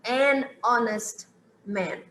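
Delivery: slow, careful "an honest man" with the H dropped; an honest man, silent H. Shown as a demonstration. Teacher models "an honest man" for students, slow and clear. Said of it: "O H é mudo".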